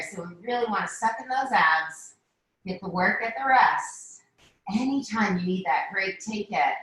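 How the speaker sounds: ordinary talk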